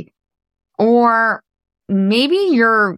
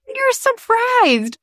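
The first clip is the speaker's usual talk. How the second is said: high, slow, mock-shocked gasp of a line; mock surprise; much slower than usual. Shown as a demonstration. Mocking listeners who act shocked every year, high and slow. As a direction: play up fake astonishment, drawn out. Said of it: "And then you're surprised".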